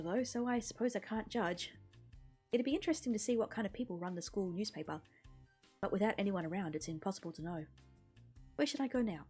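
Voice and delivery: normal talking voice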